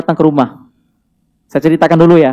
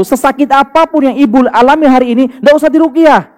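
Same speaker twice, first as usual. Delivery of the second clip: raised-pitch, insistent preaching; emphatic exhortation; 5 semitones higher than usual. Real, not acted. Preacher telling mothers no ruqyah is needed, emphatic. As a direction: drive the warning home, pitched up high. Urging congregation not to ask ruqyah.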